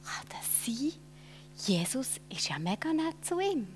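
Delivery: quiet, breathless whisper of wonder; whispering to himself. Acted. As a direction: whisper it in disbelief. Zachäus marvels to himself. (geflüstertes Staunen)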